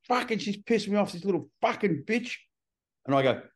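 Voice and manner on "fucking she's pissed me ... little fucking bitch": a higher, mock-angry voice as the wife; lightly voiced fed-up wife; quoting angry wife. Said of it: "And my wife goes".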